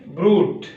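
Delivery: ब्रूट as broot, with no y-glide; teacher's demo of ब्रूट as broot; plain oo sound. Shown as a demonstration. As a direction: model ब्रूट with a pure long oo. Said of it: "ऊ निकलेगा।".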